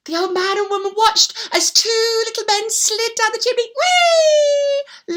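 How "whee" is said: high, loud swooping whee; gleeful whee. Acted. Little men sliding down the chimney, a loud high whee. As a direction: slide down on a big happy whee.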